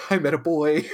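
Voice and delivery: lightly acted giddy announcement; playful retold giddiness. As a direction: gush a little, mildly giddy. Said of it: "she's giddy".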